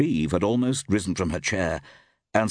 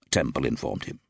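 plain reading voice

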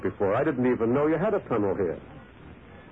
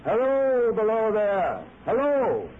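calling out; 11 semitones higher, slower than usual. Acted. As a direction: call down to a stranger far below. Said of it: "I cried".

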